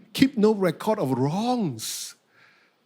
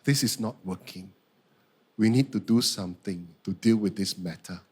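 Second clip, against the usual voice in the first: low, measured, composed statement; calm, controlled talk to a spouse; 10 semitones lower than usual. Retold or imitated. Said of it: "I calmly said to my wife".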